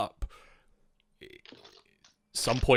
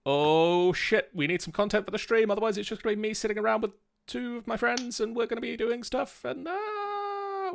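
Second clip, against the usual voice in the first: mock panic; 11 semitones higher than usual. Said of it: "I went".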